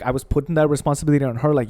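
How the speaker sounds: ordinary talk, nothing marked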